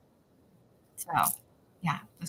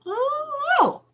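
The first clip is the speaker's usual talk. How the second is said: excited ooh. Real, not acted. Excited reaction to the next item.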